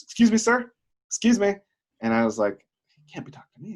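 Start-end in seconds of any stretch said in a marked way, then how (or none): 0.0-1.5 high, loud imitated call to a stranger. Stranger calls out in retold story. 3.0-3.7 high, hushed thought to oneself; puzzled aside. Retold or imitated. His puzzled thought in the story.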